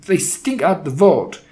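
imperious, loathing outburst; screamed. A narrator's reading. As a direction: rail against them in disgust. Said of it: "she screamed".

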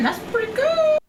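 normal talking voice

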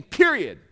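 "Period" punched out with a firm falling end; emphatic finality. Shown as a demonstration. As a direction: land "Period" hard, as the last word. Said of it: "we'll say it very emphatically".

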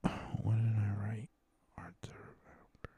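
whisper to himself. Real, not acted. Checking his notes to himself.

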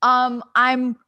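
rising from annoyed to high, loud disbelief; exasperated outburst. Retold or imitated. Actress ranting about a last-minute audition while driving, exasperated and loud. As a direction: build to a frantic, loud are you crazy. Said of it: "I'm like".

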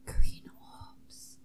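breathy whispered echo of the line; mock-sultry whisper. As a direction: whisper it back like a backing vocal.

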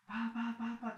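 high, quick mock gunshots; imitated gunfire. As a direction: tap out the shots like bullets.

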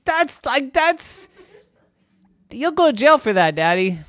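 put-on high, fast, outraged child voice; protesting boy. Irwin protesting daddy's hot water idea, high outraged voice. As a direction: scold daddy in disbelief.

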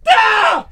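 high, loud squeaky shriek; baby scream imitation. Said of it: "Just squeak".

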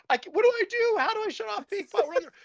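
high-pitched, squealing mock panic. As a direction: squeal it out as a frantic, helpless plea. Acted panic while driving, bot misbehaving.

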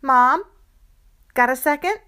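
put-on a kid putting on her best asking voice; practiced plea. Child sweetly asking mom for a second, practiced plea. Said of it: "practiced plea".

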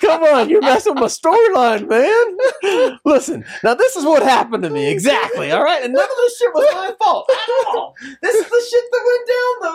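high, loud, laughing protest. Real, not acted. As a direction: protest while cracking up. Laughing mock protest about storyline.

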